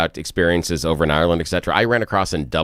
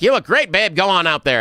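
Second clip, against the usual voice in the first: higher, gushing mock praise; lightly voiced backstage cheerleader; mock encouraging helper; 9 semitones higher than usual. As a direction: play the cheerful enabler. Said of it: "someone behind the scenes that was like".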